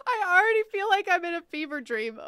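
high, loud tearful laughter; laughing so hard the tears come; real laughing fit with tears; crying laughing. Real, not acted. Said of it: "I'm literally crying".

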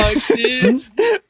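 usual speaking voice

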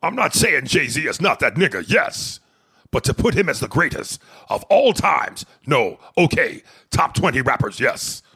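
big announced Autobot voice, forceful on every phrase; Transformers Autobot. Acted. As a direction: read it like a booming Autobot. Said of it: "like one of the Transformers".

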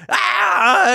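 high, loud, hammed-up "aah!"; mock panicked scream. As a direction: yelp like a cop in a silly panic. Acting out panicked officer's scream.

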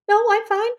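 high, forced-cheery denial; fake fine. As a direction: pretend you're fine in the rain. Rain soaking her, pretending fine.

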